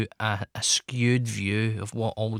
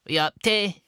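high, plummy mock posh English accent on Yup; 8 semitones higher, 4 dB louder than usual. Imagining the goths will talk posh, in a high mock posh English accent. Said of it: "they're gonna speak posh".

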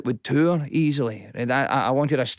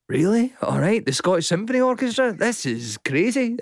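higher, incredulous, slowing on "crazy"; retold disbelief; 8 semitones higher than usual. Comedian recalling the orchestra offer, incredulous. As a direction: replay his own amazement.

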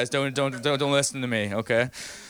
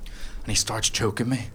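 normal talking voice

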